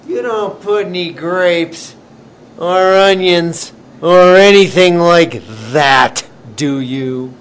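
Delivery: put-on loud, slow doubting old lady; suspicious old lady. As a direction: grill him like a fussy old lady.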